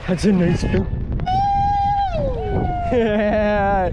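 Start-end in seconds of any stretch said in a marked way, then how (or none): 0.8-2.9 shouting laugh. Real, not acted.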